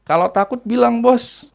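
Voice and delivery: teasing "Kalau takut bilang, Bos" pitched high; joking taunt. Retold or imitated. Narrator teases the spirit he is facing, high playful jab. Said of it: "sambil agak sedikit bercanda".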